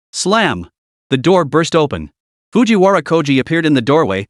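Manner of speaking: plain reading voice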